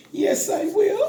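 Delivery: high laughing squeal; laugh-cry through onion tears. Real, not acted.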